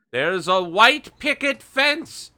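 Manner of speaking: high, slow, dramatic elder rabbit; slow high ominous rabbit voice; ominous elder reveal. Acted. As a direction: draw it out like a grave warning.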